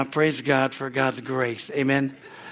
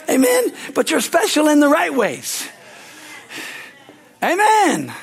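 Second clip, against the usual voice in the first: full-force preaching; 8 semitones higher, 4 dB louder than usual. Acted. Pastor preaching to the congregation, a loud fervent shout. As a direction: preach it out and lift the room.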